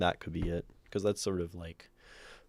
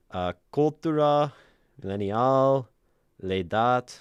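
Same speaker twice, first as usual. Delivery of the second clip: put-on French accent; 5 semitones higher, 9 dB louder than usual. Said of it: "do shitty French accent".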